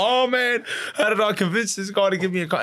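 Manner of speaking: very high-pitched stunned disbelief; retold shock. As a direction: re-enact being floored, voice shooting right up. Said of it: "I was in shock".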